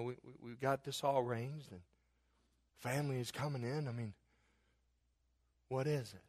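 soft, low, tender voices of Mary and Joseph; hushed intimate dialogue. Acted. Mary breaking the news to Joseph, soft and tender. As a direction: speak it gently, like bad news to a loved one.

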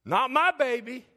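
high, loud indignant protest; upset parent's outburst. Retold or imitated. Parent protesting a foul called on his son, loud and indignant. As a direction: burst out in protective outrage. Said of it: "I was upset".

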